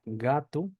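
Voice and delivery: "gato" ending in an oo sound, GA-tu; final O as U. Shown as a demonstration. Teacher giving an example word, final O pronounced as U. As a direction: say "gato" the Brazilian way, final O turned to U. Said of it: "se pronuncia como U".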